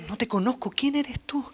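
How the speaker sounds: soft, sincere "No te conozco, ¿quién eres tú?". Retold or imitated. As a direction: say it gently, honestly puzzled. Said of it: "Él está diciendo su sinceridad". (sincero y suave)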